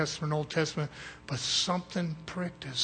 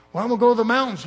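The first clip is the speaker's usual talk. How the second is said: louder, higher insisting reply; mock defensive reply; 5 semitones higher, 8 dB louder, much faster than usual. Preacher voicing the retiree who insists on the mountains, loud. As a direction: push back loud and a bit high.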